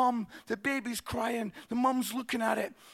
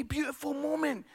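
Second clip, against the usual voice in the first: usual speaking voice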